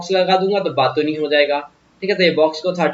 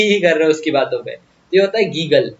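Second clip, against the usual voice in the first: usual speaking voice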